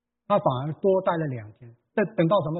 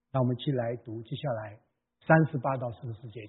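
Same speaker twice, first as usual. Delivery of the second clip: ordinary talk, nothing marked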